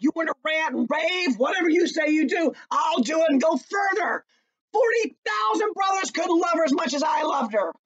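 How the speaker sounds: high, loud paraphrased challenge; ranting paraphrase. Retold or imitated. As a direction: shout it out as Hamlet would. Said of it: "He's like".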